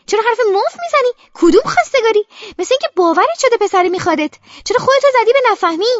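sharp, high, loud scolding; scolding aunt. A narrator's reading. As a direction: tear into the niece with contempt.

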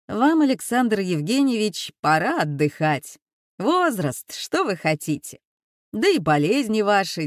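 mock condescending boss. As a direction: play the smug rector sending him off. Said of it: "как разговаривал с ним новый начальник".

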